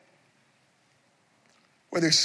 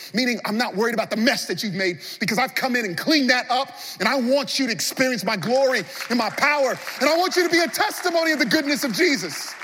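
high, loud, building preacher voice as Jesus; full-force preaching; 7 semitones higher, 4 dB louder, faster than usual. Acted. Jesus pressing Peter and the church, full-force preaching. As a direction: pour it out over the church, louder each line.

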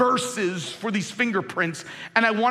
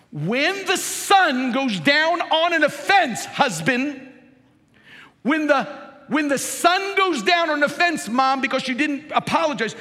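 preaching at full force; 6 semitones higher, 3 dB louder than usual. Acted. Pastor preaching to husbands and moms, full force. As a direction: drive it home to the congregation.

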